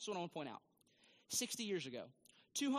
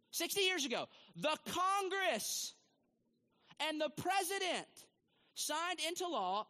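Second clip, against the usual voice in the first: loud, high, slow preaching emphasis; emphatic preaching; 8 semitones higher, 4 dB louder than usual. Real, not acted. Preacher to church, loud, high and slow. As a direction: bear down on each phrase for the congregation. Preacher driving a point home.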